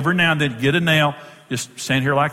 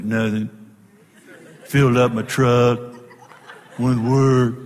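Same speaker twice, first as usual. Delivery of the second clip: grunting husband; 4 semitones lower than usual. Retold or imitated. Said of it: "You don't say".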